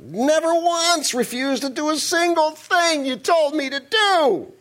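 put-on whining older brother. Older brother complaining to his father, hammed-up whine. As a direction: whine like a sulky teenager, drag it out. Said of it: "He was whining".

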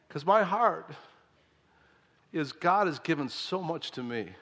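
normal talking voice

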